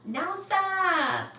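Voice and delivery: high, loud shout of a name; excited call. Real, not acted. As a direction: sing out the name happily. Calling out a viewer's name.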